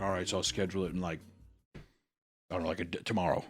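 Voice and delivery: half-hearted shrug of a line; mock offhand mumble. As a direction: mutter it lazily. Mumbled retold thought.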